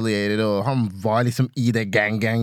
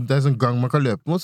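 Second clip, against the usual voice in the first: normal talking voice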